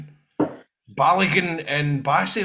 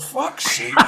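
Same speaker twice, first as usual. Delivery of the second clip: higher, laughing fuck's sake; laughing exasperation; 8 semitones higher, 3 dB louder, slower than usual. Retold or imitated. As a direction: retell the outrage while cracking up. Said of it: "I was like".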